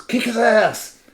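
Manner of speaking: high, very loud drawn-out screech; egging-on yell. Retold or imitated. Nadine cheering on a fight from the sidelines, stretched high yell. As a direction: holler it slowly, stretched and piercing. Companion eggs him on again.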